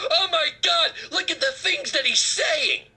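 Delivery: high, laughing mock gasp of shock; mocking outraged liberal. Host mocks offended liberals with laughing fake shock. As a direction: parody their fake horror with a laugh. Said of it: "and you go".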